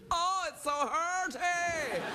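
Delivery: high, slow, hammed-up whimper; whiny pain. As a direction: drag it out like a big baby. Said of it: "I was like".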